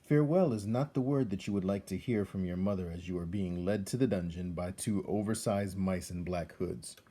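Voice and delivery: ordinary delivery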